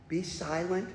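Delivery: soft, low, prim "Be silent"; polite hush. Retold or imitated. Preacher mocks the polite Bible wording, soft and prim. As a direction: say it almost too nicely, hushed and low. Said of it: "Don't you love when Scripture makes something really nice".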